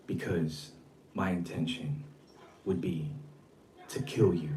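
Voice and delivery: very low, near-whispered menace, broken by pauses; hushed, deep imitation of his threat, phrase by phrase; mocking his talk. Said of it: "So he talks like this".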